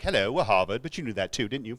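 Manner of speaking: put-on snooty Harvard accent; mock-pretentious Harvard accent. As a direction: play Harvard as a self-important snob with an accent. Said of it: "Harvard talks in that accent".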